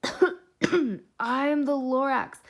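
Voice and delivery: slow, loud, coughing Lorax; hoarse proclamation. Acted. Lorax chokes on smog, slow loud announcement. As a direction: announce himself through the smoke. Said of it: "coughs, smoggy".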